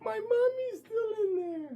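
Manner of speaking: little sister crying as the bus leaves; crying child. Acted. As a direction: wail for her mother. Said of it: "My sister cries".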